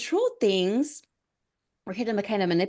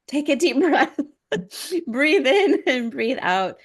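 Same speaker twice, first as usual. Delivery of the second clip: higher, louder coaching through a breath; retold pep talk to calm herself; slow breathing instructions, acted out; self-coaching; 5 semitones higher, 4 dB louder, slower than usual. Talking herself down from panic.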